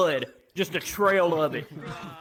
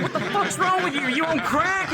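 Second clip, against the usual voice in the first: high, fast laughing mock clerk; mocking hotel clerk; 12 semitones higher, 3 dB louder than usual. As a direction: squeak out the clerk's disbelief, cracking up. Mocking hotel staff reaction.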